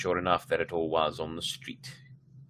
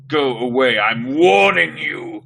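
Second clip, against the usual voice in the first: slow, loud menacing growl; 4 semitones higher, 10 dB louder, slower than usual. Acted. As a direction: threaten like a monster. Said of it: "Carly Beth growled".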